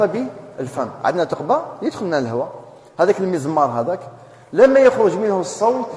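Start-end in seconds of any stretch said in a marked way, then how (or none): none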